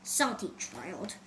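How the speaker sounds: low, soft, slow grumble; mumbling pirate. A narrator's reading. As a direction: mutter it under your breath. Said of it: "the pirate mumbled".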